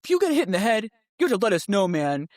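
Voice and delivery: stern dad. Retold or imitated. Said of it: "Justin's like".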